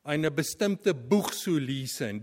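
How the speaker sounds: ordinary talk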